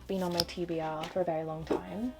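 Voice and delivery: ordinary delivery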